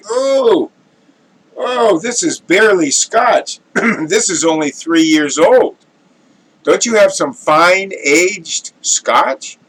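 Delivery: high, loud, slowed scoff of a snob; offended connoisseur. Acted. Connoisseur to bartender, high loud indignant scoff. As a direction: sound scandalised by cheap scotch. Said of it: "the very distinguished gentleman".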